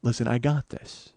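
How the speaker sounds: low, soft, deliberate; cool reassurance. Retold or imitated. [name] answering tour outrage, quiet calm assurance. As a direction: play her unbothered, almost murmuring. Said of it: "she's gonna go".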